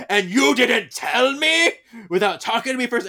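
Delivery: hammed-up accusing outburst; outraged yell. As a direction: yell it like a betrayed husband. Acted quarrel, Henry outraged.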